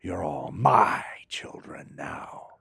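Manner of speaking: put-on "You're all my children now" breathed slowly in a whisper, a weight on "my"; slow whisper, the way the line should have gone; whispered menace. Shown as a demonstration. Actor showing how the line should have been said: slow whisper. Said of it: "It should have been".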